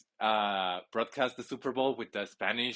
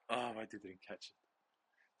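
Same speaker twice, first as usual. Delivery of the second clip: deflated; 10 dB quieter, slower than usual. Real, not acted. Deflated after a missed catch.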